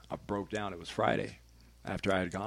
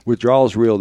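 louder, hammering the point home; emphatic; 3 semitones higher, 17 dB louder than usual. Real, not acted. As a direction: warn them, stress Withdrawal's. Stressing a warning to listeners.

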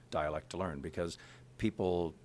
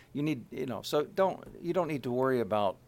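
usual speaking voice

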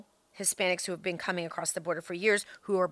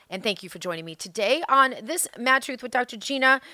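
ordinary talk

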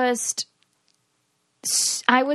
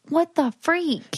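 higher, drawn-out retold bafflement; slow, pitched-up dismay; exasperated disbelief; 5 semitones higher, slower than usual. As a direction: stretch it out, incredulous. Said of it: "And I'm like".

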